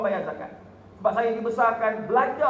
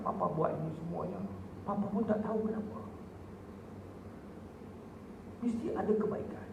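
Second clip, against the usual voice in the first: quiet; 5 semitones lower, 11 dB quieter than usual. Retold or imitated. Father's tearful words quoted quietly.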